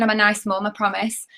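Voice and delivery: usual speaking voice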